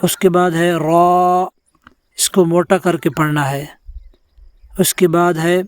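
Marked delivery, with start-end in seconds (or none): none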